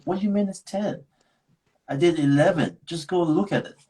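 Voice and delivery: mock-outraged comeback, pitch jumping high; retold indignant protest. Retold argument with agent.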